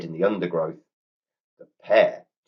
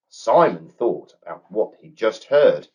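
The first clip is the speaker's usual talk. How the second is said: usual speaking voice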